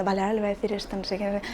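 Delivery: very soft, under-the-breath thinking aloud; inner thought. Retold or imitated. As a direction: voice a private thought while pretending to listen. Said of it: "escuchando a la otra persona y pensando". (pensamiento interior, dicho muy bajito)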